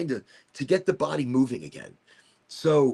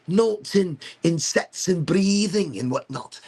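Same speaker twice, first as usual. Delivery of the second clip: put-on higher-pitched, loosely Scottish accent; 5 semitones higher than usual. Lifter defending himself about the weights, Scottish accent. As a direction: slip into a bit of a Scottish brogue. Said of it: "I don't know why the accent came out".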